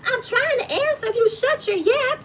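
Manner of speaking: squeaky high mocking voice of a snippy woman; mocking Kimmy. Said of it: "then she wants to be all hype, talking more to Destiny to say".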